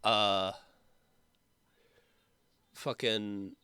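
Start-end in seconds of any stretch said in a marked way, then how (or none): none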